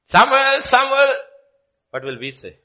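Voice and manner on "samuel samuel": loud, high, drawn-out calling. Acted. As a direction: call the sleeping boy by name. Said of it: "little voice sleeping before the ark".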